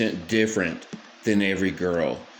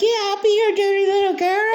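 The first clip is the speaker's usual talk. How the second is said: very high, fast, coy mock girl voice; mock little girl voice; 4 dB louder, faster than usual. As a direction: purr it coyly like a little girl, hammed up. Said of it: "She's like".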